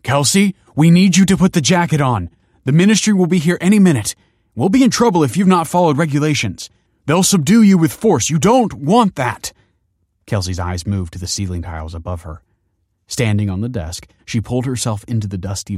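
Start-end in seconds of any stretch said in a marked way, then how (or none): 0.0-9.5 high, loud, urgent shouting; yelling through a keyhole. A narrator's reading. Principal to barricaded pupil through keyhole, yelling urgently. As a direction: yell so it carries through a locked door. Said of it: "was yelling through the keyhole".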